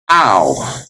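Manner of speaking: Ow as one glide from ah to oo; diphthong as in out. Shown as a demonstration. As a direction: sound Ow like the vowel of out. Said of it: "as in out".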